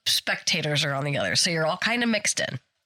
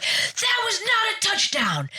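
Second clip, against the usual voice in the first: angry coach yell; slower than usual. Retold or imitated. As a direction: bellow it like a furious coach. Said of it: "The coach is like".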